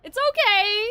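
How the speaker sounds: ordinary talk, nothing marked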